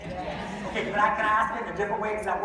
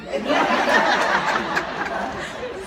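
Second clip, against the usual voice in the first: high breathy disbelieving laugh; giddy high laugh; 8 semitones higher, 7 dB louder than usual. Retold or imitated. Giddy reaction to her yes.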